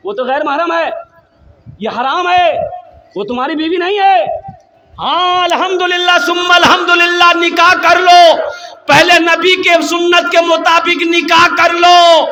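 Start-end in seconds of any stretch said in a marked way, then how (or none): 5.0-12.3 preaching shout. Real, not acted. Preacher exhorting the crowd.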